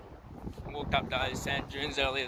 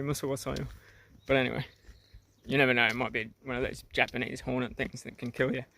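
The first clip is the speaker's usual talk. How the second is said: ordinary talk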